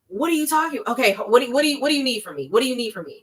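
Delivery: mock-flustered Uber driver cornered by a caller; stressed frustrated driver. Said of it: "So you're stressed, you're agitated, you're frustrated".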